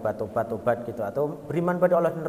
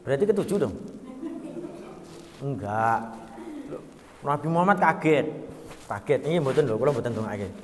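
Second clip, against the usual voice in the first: normal talking voice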